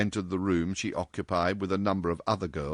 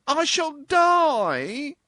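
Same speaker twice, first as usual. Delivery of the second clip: high, slow, louder theatrical wail; 6 dB louder, much slower than usual. Acted. Brunette mourning the lost tea, hammed-up high wail. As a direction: wail it like a tragedy. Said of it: "wailed the little brunette".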